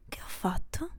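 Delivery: Che ho fatto? whispered with a rising end; hushed, stunned whisper. Acted. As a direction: breathe Che ho fatto? as a soft whispered question. Repeats the question, whispered.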